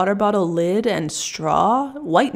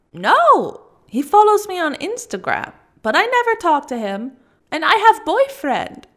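much higher and louder, slowing at the end; agitated outburst; 9 semitones higher, 4 dB louder than usual. Acted. Russian woman insisting she never talks to the stalker. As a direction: blurt it out in distress.

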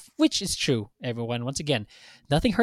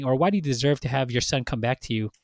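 ordinary delivery

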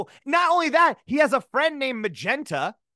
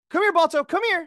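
much higher, louder dog call; 11 semitones higher, 4 dB louder than usual. Shown as a demonstration. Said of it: "We'll take it again".

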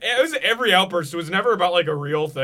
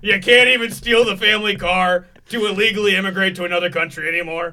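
mocking dad; 4 semitones higher, 7 dB louder than usual. Imitating dad's rant.

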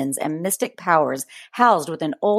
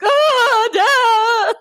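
very high, louder screaming cry; mock terrified scream; 6 dB louder than usual. Two frightened kids seeing their dad, high scream. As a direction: shriek like a scared kid.

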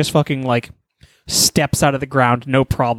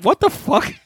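much higher, startled cry of disbelief; shocked yelp; 12 semitones higher than usual. Retold or imitated. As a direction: squeal it in sheer shock. Mole shocked by Saitama.

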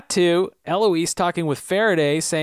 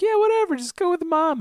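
put-on much higher, airy dismissive girl voice; whiny girlfriend; faster than usual. Girlfriend waving off the snub in a high mocking voice. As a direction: brush it off in a high girly voice.

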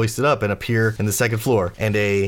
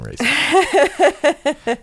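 mock shout; 7 dB louder than usual. GM blurts in mock outrage.

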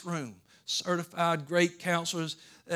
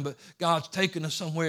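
usual speaking voice

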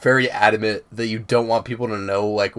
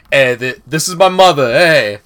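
put-on loud, higher-pitched Boston accent; 3 semitones higher, 8 dB louder than usual. Telling friends how the video guys riff, in Boston accent. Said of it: "the Boston accent. They're going like".

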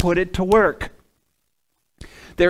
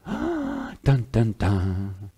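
mock dramatic sting; 7 semitones lower, 4 dB quieter, much slower than usual. Mock dramatic music after pause.

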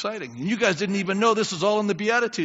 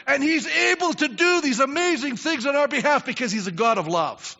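ordinary talk, nothing marked

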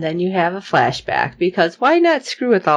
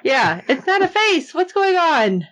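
high, incredulous mock bewilderment; baffled protest; 9 semitones higher than usual. Co-host reacts to the faceless bleeding thing, baffled. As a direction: keep it pitched up, utterly confused.

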